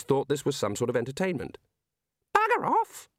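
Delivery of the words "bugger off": tiny, very high bird voice; twittering budgie. A narrator's reading. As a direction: twitter it like a talking budgerigar. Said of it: "they twittered".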